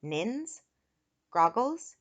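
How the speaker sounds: "Nins" and "Groggles" each rising separately; puzzled repeat. A narrator's reading. Eric repeats strange names, "Nins", "Groggles" rising questioningly. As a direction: query "Nins", then "Groggles" as strange words. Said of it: "Eric repeated".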